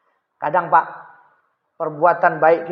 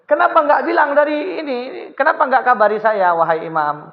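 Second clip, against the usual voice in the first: much higher, pressing why-didn't-you-tell-me; high, distressed reproach; aggrieved protest; 7 semitones higher than usual. Acted. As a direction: reproach him in disbelief. Neighbour protesting.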